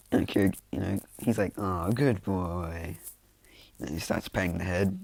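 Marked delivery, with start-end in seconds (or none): 1.6-3.0 long drawn-out, put-on pet praise; cooing praise. Izuku praising the Nomu that caught him, slow cooing. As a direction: stretch it very slowly, as to a dog.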